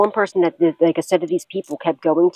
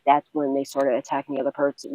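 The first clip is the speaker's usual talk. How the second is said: ordinary talk, nothing marked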